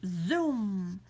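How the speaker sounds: zoom breathed out as a whisper; voiceless whooshing zoom. Acted. Sound effect of flying off.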